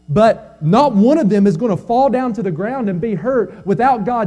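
loud, high, ringing sermon voice, slowing on key lines; full-force preaching. Acted. Pastor rousing the grieving congregation, full-force preaching. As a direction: preach it out to the whole church.

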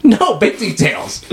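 high, drawn-out protest; indignant correction. Real, not acted. Rebutting "minor details" in banter.

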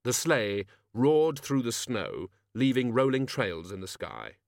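ordinary delivery

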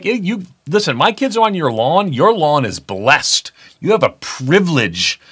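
high-pitched, hammed-up protest, slowing at the end; mock indignant retort. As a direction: tell off the neighbour in playful outrage. Acted retort to complaining lawn neighbour.

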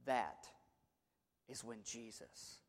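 dropping to a near whisper for effect; hushed, breathy preacher's whisper. Real, not acted. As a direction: pull them in with a hush. Quiet dramatic sermon climax.

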